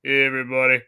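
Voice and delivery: low, deadpan put-on deep voice; Patrick Warburton voice. Greeting coworkers at work with a put-on voice. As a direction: fake a deep radio voice. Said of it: "I'm Patrick Warburton".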